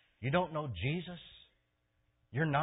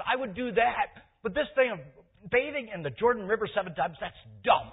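loud, high, indignant tirade; acted outraged protest, pitched high and loud; upset Naaman; 10 semitones higher, 10 dB louder than usual. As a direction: rant at full voice about the insult. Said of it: "Naaman got all upset".

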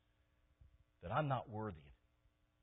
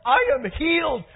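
very high, very loud cries of praise; healed old man shouting hallelujah; joyful shouting; 20 dB louder than usual. Retold or imitated. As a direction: shout it out, jumping for joy. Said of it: "running around the room shouting".

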